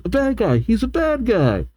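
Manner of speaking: high, lightly voiced character; Shauna. Shauna explaining to Clement, lightly voiced character. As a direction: pitch it up for a simple explanation. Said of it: "Shauna says".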